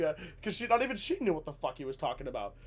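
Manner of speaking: normal talking voice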